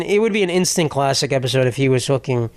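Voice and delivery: ordinary talk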